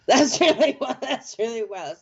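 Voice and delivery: delighted laugh of disbelief; laughing, amazed at the coincidence; laughing amazement. Real, not acted. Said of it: "that is really wild".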